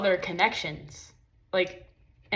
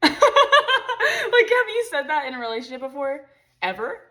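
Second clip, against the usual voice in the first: real laugh, higher and louder; 7 semitones higher, 7 dB louder than usual. Real, not acted. Asking viewers while laughing at mean partners: real laughter. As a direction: laugh through the question. Laughing at her own example.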